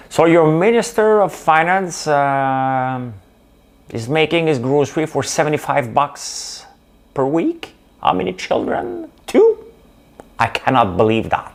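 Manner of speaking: hammed-up UK accent, disbelieving; British accent. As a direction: play an incredulous British interviewer. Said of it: "avec mon accent, on est au U K".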